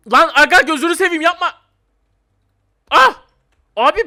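very loud, high-pitched begging; frantic gamer yelp ending in a cry; panicked pleading shout. Real, not acted. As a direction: yell for mercy in sudden panic. Pleads as attacked in the game.

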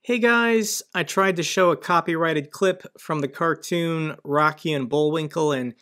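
ordinary talk, nothing marked

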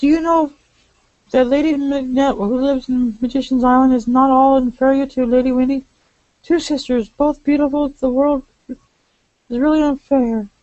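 dreamy sigh on the end; high lady voice. Acted.

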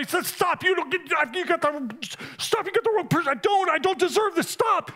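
high, overwhelmed protest. Retold or imitated. Preacher voicing himself refusing Jesus' hug, high and pleading. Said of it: "I wanna say".

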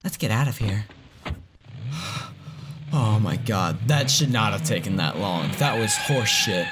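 ordinary talk, nothing marked

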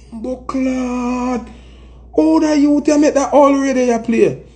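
high, astonished Jamaican parent; amazed parent. Retold or imitated. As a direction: exclaim like a stunned elder. Parent reacting to kid's radio.

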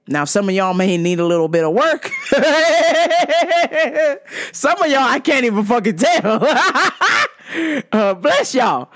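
giggling through the joke; laughing teasing. Real, not acted. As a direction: tease them while laughing.

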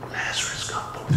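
soft unvoiced whisper. Preacher imagining Jesus quietly calling Lazarus, whispered. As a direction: breathe it, barely audible. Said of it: "Jesus could have said".

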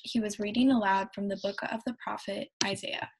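ordinary talk

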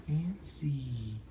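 hushed whisper. A narrator's reading. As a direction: drop to a whisper. Whispered end of list.

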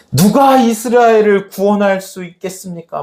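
high, urgent appeal; acted pleading question. Elisha asking who can save Israel, acted plea. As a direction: cry the question up to God.

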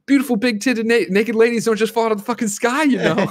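high, loud astonished shout; mock yell, pitched high and loud; excited yell. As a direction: shout it up at the sky in wonder. Jay yells at falling man.

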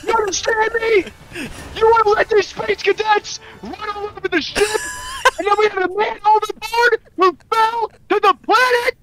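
roleplayed officer chewing out his crew; angry commanding officer. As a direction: berate the cadet like a furious superior. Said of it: "Did you hear any of my acting".

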